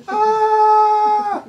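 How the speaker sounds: very high, loud storybook scream; long hammed-up shriek of fright; terrified scream. Reader voices character facing the monster, screaming.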